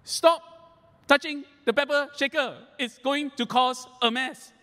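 high, sharp mock scolding; a high-pitched grandmother scolding the boy; imitating mom. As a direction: play the mother snapping at the grandson. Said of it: "my mom would go".

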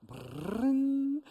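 high rolled brrrring like a harp glissando; playful vocal harp flourish; harp trill. Retold or imitated. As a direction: mimic a harp sweeping in. Imitating heavenly harp music.

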